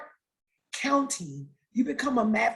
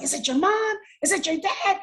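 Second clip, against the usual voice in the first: higher-pitched imitation of anxious questions; urgent retold questioning; 8 semitones higher than usual. Boyfriend asking who has died, urgent questioning. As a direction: act out urgent guessing, pitch raised.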